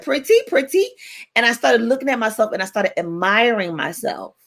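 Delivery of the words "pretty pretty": cooing praise. Retold or imitated. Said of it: "She said".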